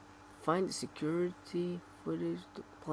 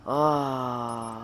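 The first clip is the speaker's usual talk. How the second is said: exasperated cry; 8 dB louder than usual. Real, not acted.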